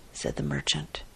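ordinary delivery, nothing marked

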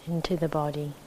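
calm, measured meditation guidance with long silences; unhurried, even voice leaving space between phrases; guided meditation. Acted. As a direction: settle listeners into the body, phrase by phrase.

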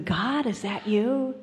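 acted calling out. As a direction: call up to God in hope, playing the cliffhanger. Man on cliff calling to God.

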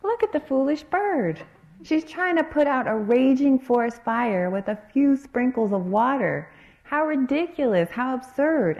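high, loud scornful jeer; jeering gods laughing down at the bird, loud and high; mocking gods. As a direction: sneer at the foolish bird. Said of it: "They exclaimed".

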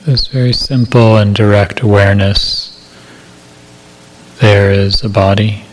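calm, measured meditation voice with long silences; guided meditation. Acted. Meditation teacher settling listeners into body awareness, calm guided meditation. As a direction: guide listeners gently into body awareness, unhurried, evenly paced.

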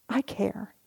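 soft whispered reassurance; tender unvoiced whisper. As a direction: breathe it gently like comforting yourself. Inner awareness comforting you.

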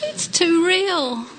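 high, slow mock wail; drawn-out, high childlike lament, played for laughs; wailing child. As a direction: repeat a cartoon boy's plaintive cry. Repeats boy's wail after laughter.

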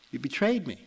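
wounded, accusing outburst; mildly acted betrayed protest; hurt accusation. A narrator's reading. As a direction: accuse a friend of letting you down. Weeping farmer accuses his ox.

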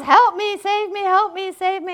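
high, loud cry for rescue; frantic plea. Acted. Man hanging from a branch begging God, frantic cry. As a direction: beg urgently, hammed-up panic. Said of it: "And the man says".

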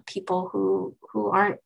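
ordinary talk

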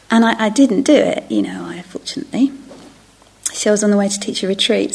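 normal talking voice

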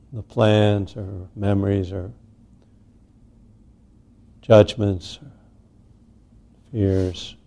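unhurried meditation guidance with long pauses; guided meditation. Real, not acted. Teacher settling a group into sitting meditation, unhurried guidance. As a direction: ease each phrase out, leaving space after it.

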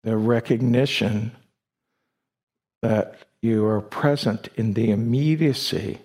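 ordinary delivery

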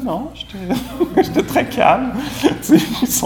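high, laughing through the words. Real, not acted. To the audience, laughing at his own pretended calm. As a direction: crack up mid-sentence at yourself.

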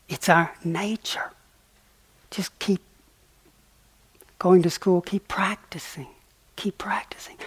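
soft whispered encouragement; tender encouragement. Real, not acted. Teacher encouraging his listeners to keep practicing, whispered. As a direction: gently urge them to keep going. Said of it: "encouraging listeners softly".

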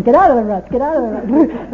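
loud imitated yell, urgent; imitated shout. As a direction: shout it as the car scrapes. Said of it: "He's going".

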